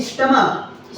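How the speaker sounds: स्टमक with a final K sound; pronunciation of स्टमक. Shown as a demonstration. Teacher repeating it for the class, स्टमक ending in K. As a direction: end स्टमक on K, not CH. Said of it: "इसको बोलते हैं".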